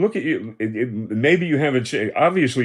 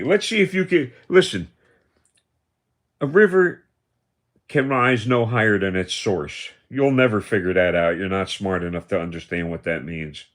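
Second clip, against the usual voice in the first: ordinary delivery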